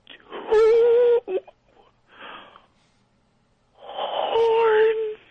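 rising, hammy final words; overacted death scene. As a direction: gasp out the last revelation. Dying last revelation.

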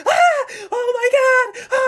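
loud, very high mock shriek; hammed-up hysterical fan screams; screaming women fans. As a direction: scream like swooning concert fans. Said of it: "the women were like".

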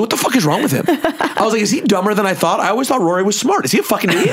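high-pitched, incredulous ranting at normal volume; mock outrage, laughing at the end; retold pissed-off rant.

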